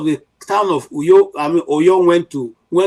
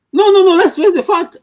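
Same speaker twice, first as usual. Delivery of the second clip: much higher and louder, cutting in hard; exasperated outburst; 6 dB louder than usual. Real, not acted. Commentator to listeners, bursting out loudly in frustration. Heated rant about the country.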